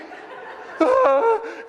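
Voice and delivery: real high-pitched laugh. Real, not acted. Preacher laughing at his childhood story, high laugh. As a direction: burst out laughing at the memory.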